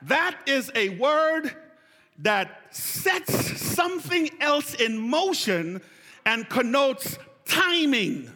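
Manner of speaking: high, loud exhortation to the congregation; fired-up preacher's call; full-force preaching. Real, not acted. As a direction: preach it out, raising the pitch and leaning on each phrase.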